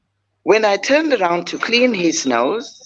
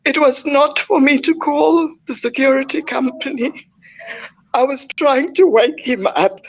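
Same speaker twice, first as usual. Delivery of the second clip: telling it through tears; real grief catching in the throat, pitched up; tearful; 6 semitones higher than usual. Real, not acted. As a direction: voice rising and breaking with grief, higher. Mother recounting son's death.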